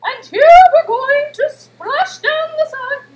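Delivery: high, loud, drawn-out TV cook impression; hammed-up fluting voice, stretching the words; Julia Child. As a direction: belt it out high and slow like a grand TV chef. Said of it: "That's my best impression".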